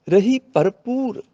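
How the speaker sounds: slow ਭਰਪੂਰ, ਪੂਰ starting on a p; ਭਰਪੂਰ with a clear p. Shown as a demonstration. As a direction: stretch ਭਰਪੂਰ, first bh then p. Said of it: "ਇਹਨੂੰ ਭਰਪੂਰ ਨਹੀਂ ਬੋਲਣਾ".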